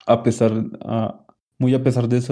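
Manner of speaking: normal talking voice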